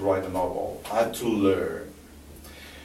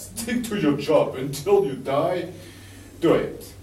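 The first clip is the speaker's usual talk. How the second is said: laughing; 7 semitones higher than usual. Real, not acted. Laughing through advice.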